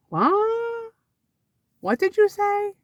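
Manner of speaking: high, shocked double take; shocked what. Retold or imitated. Teacher hearing the Drano comment, shocked. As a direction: act stunned by what she said. Reacting to mom's teacher quote.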